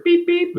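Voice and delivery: high piping beep beep; playful high electronic beeps; beeper noise. Retold or imitated. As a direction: imitate a scanner beeping. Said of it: "with the little red beeper".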